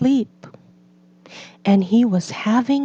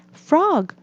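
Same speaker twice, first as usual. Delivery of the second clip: Toad showing off; 7 semitones higher than usual. A narrator's reading. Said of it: "said Toad".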